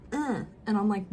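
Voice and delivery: normal talking voice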